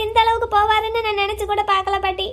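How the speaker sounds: ordinary delivery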